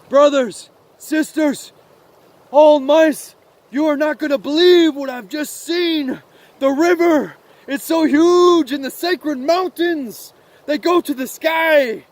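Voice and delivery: high, loud, breathless-with-news proclamation; excited little mouse. Acted. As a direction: call out the wonder to all the mice. Mouse announces his wondrous discovery.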